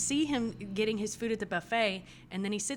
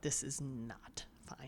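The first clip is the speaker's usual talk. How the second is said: slow, hushed, low dread; alarmed aside; 5 semitones lower, 9 dB quieter, slower than usual. Acted. Sister looking at the blood, grimly whispering her alarm. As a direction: whisper it slowly, weighing each word. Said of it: "she's looking at the blood like".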